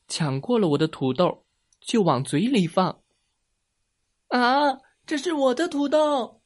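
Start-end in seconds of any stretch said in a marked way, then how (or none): 4.3-6.4 very high, wailing objection; child's alarmed protest. A narrator's reading. Boy sees alien grab his potatoes, high alarmed cry. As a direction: cry out as your things are snatched.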